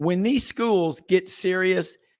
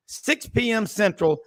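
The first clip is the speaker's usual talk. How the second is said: ordinary talk, nothing marked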